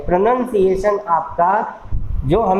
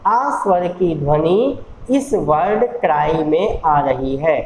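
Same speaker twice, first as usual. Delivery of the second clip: ordinary talk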